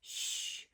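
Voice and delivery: a sharp whispered shh; breathy shush before the gossip; gossipy shush. Acted. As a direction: hush like a snooty gossip. Gossiping sheep hush.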